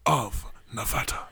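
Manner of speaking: put-on reading it in the man's own accent, lightly; Bundy's accent. Host reads Bundy's quote in his accent. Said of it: "this is the accent that he would".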